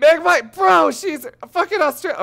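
a loud incredulous squeal; shocked outburst. Real, not acted. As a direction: blurt it out amazed. Shocked at huge world size.

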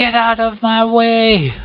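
high, slow mock yell at a door. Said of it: "I'm yelling at the door".

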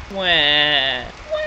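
drawn-out pretend "wah"; mock baby cry. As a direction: fake baby wail. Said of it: "gonna cry like a baby".

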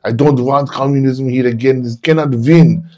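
hint of foreign emigre fervor; teacher on politics. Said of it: "He was like".

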